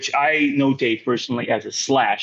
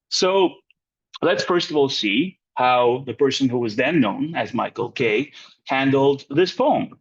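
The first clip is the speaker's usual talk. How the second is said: ordinary talk, nothing marked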